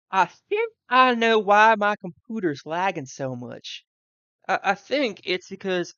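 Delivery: slipping into a Southern accent; Southern twang slips out. Real, not acted. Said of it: "sorry, my southern accent just came out for a bit".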